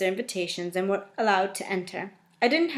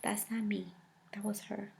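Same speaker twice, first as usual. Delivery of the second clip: a much softer unvoiced whisper; whispered aside; 13 dB quieter than usual. Reader disowning the heroine to listeners, whispered. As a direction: confide it under your breath.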